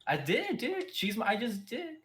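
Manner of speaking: high-pitched, incredulous objection; exasperated protest. Real, not acted. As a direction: protest in a rising, squeaky pitch. Protests being told to say it in character.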